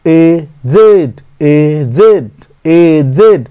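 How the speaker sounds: aged drawn out slowly in two syllables; teacher spelling out aged syllable by syllable; syllable drill. Shown as a demonstration. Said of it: "মানে এজেডটা ডাইসিলেবল".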